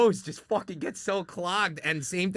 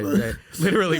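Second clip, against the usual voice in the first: real laughter breaking into the words, louder; amused, louder, laughing through it; 5 semitones higher, 6 dB louder than usual. Real, not acted. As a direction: laugh while getting the word out. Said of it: "See, I'm laughing".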